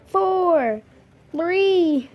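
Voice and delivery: rising excited countdown, high and loud. Real, not acted. Gamer counting down to the launch, excited.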